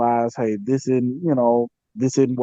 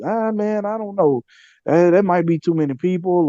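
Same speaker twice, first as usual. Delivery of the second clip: higher-pitched mock reluctance; reluctant naysayers; 7 semitones higher than usual. Friends talking him out of his giveaway, mock reluctant. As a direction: imitate friends making hesitant excuses, pitched up. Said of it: "talk us out of it".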